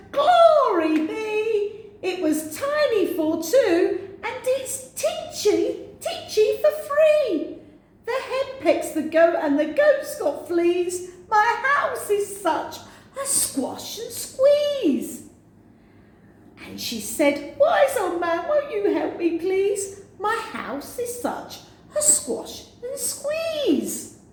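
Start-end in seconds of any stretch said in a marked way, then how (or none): 0.1-15.2 crying out. Acted. Old woman crying out over the crowded house, high and wailing. 17.6-24.2 beg, stretching the words; pleading old woman. Acted. Refrain plea.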